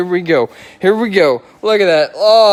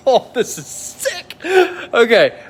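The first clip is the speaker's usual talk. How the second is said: thrilled gasp; 7 semitones higher, slower than usual. Real, not acted. Reveals the coated car.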